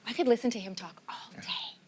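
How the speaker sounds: hushed aside whisper. Real, not acted. Aside about the chef, off-mic.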